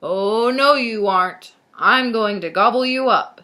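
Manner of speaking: very loud, drawn-up troll threat; booming monster threat; menacing troll. A narrator's reading. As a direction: bellow it like a hungry storybook troll. Troll threatens to eat goat.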